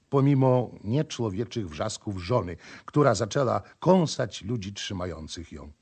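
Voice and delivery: plain reading voice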